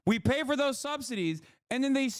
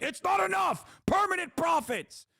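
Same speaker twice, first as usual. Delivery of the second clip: high, shouted cartoon-villain rant; mock greedy billionaire; 6 semitones higher than usual. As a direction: scream it like a greedy tycoon. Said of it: "these motherfuckers are still like".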